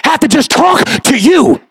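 pleading sermon at full force; fiery preaching. Acted. Preaching, building.

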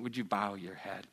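ordinary talk

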